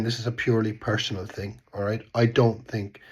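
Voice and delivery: ordinary delivery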